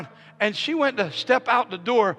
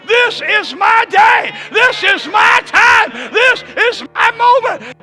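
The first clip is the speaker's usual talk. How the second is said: building from raised voice to slow, high shout; full-force preaching; 9 semitones higher, 11 dB louder than usual. Real, not acted. Preacher declaring God's calling, fervent building shout. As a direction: escalate each declaration into a roaring climax.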